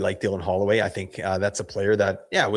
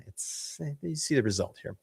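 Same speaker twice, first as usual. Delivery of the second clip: losing voice, barely voiced; cracking, hoarse and faint; 5 semitones higher, 8 dB quieter than usual. Real, not acted. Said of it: "Sorry, I'm losing my voice".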